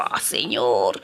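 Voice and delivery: long-drawn exasperated groan to God; acted exasperation. Parents at breaking point appeal to God, acted exasperation. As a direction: groan it low, worn out. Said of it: "llegamos al punto así como".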